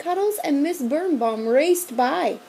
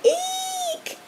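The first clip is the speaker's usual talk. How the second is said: very high squeal of fright; children shrieking at the monster; shrill eek. A narrator's reading. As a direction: shriek in terror. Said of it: "screamed the children".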